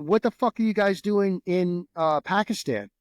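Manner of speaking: retold angry radio demand; pissed-off radio call. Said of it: "they fucking radioed us all pissed off".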